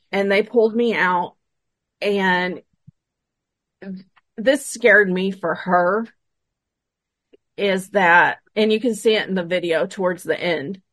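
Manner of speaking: ordinary talk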